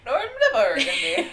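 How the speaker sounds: laughing. Real, not acted.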